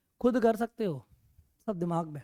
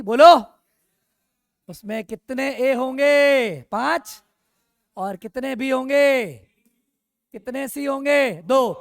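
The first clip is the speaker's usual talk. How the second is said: loud, drawn-out questions, pitched up; calling out to class; 3 semitones higher, 9 dB louder, much slower than usual. Real, not acted. Teacher prompting the class for the count, calling out. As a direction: stretch each question out and call it loudly.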